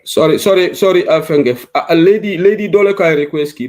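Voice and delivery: loud call to get a caller's attention. Real, not acted. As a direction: raise the voice to reach the caller over the line. Host calls out to a caller.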